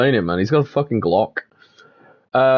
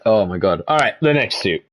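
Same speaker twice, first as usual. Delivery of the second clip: ordinary talk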